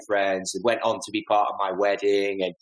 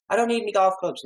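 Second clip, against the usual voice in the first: put-on a poor, much higher Irish accent; 11 semitones higher, faster than usual. Irish rep waving off the golf clubs, higher mock Irish accent. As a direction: put on the Irish rep's brogue badly. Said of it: "the Irish guy whose accent I did so poorly".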